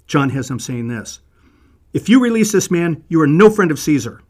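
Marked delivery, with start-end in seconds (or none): 1.9-4.2 much higher and louder, a threatening scream; screaming leaders. Acted. Priests scream their threat at Pilate, much higher and louder. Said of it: "imagine them screaming this".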